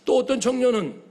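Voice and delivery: ordinary delivery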